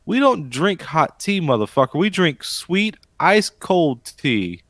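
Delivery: drawn out, savoring each word; slow, proud emphasis; slow emphatic. Real, not acted. Proud Southern tea declaration.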